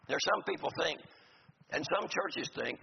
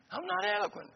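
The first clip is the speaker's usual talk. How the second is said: I'm not eloquent squeaked much higher, half laughing; self-mocking confession. Preacher jokingly admits he isn't eloquent either, high and playful. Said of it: "Me too".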